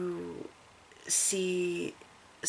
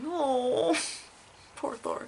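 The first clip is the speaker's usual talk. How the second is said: pitying; 8 semitones higher, much slower than usual. Retold or imitated. Recalls own sympathetic reaction.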